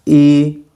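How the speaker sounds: "E" as a high front vowel, like English double E; Mandarin i vowel. Shown as a demonstration. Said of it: "I is called a high front vowel".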